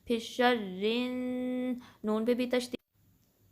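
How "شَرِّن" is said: شَرِّن slow, final n held humming; ghunna. Shown as a demonstration. Teacher demonstrating ghunna, long humming شَرِّن. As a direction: sustain a nasal n on شَرِّن. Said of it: "نون مشدد کا غنہ ہوگا".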